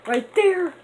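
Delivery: high, loud exclaim; excited squeal. Real, not acted. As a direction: point it out with a thrill. Pointing out the perk.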